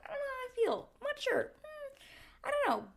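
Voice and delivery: high, unsure musing. Retold or imitated. Unsure about the engagement, musing high. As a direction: wonder aloud in a high voice. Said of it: "I was kind of like".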